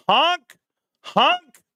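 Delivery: high honks, each jumping upward; mock horn honks. Streamer rallying the gang to honk, high mock horn. Imitating a car horn to viewers.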